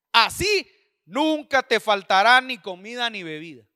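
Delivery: slow, loud preaching read; scripture proclamation. Acted. Preacher reads the promise to the church, forceful slow proclamation. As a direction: ring out the promise phrase by phrase.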